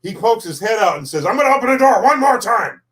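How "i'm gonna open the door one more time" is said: high-pitched mock exasperated call; retold last warning, pitched way up; impatient warning. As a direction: imitate a fed-up doorman calling out. Retold warning from impatient door man.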